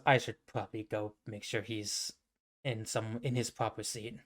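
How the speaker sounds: low, soft mutter; subdued, resigned. Acted. Anubis leaving to check on his brother, resigned and quiet. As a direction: say it quietly as you excuse yourself. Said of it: "And then he just goes".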